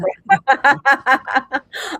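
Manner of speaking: loud, rattling run of laughter; helpless laugh at your own blunder; real laugh. Real, not acted. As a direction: burst out laughing at yourself. Laughing at own foggy brain.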